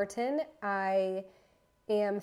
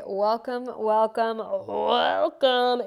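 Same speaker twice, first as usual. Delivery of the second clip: each welcome louder and bigger; building welcome; 2 semitones higher, 11 dB louder, slower than usual. Acted. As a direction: roll out a growing, hammed-up welcome. Building podcast welcome, loud.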